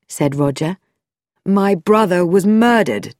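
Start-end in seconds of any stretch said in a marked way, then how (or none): 1.5-3.2 grim, controlled anger, leaning on murdered; bitter accusation, murdered hit hard; a touch of fury in the brother's voice; angry. Acted. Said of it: "Roger's angry eyes".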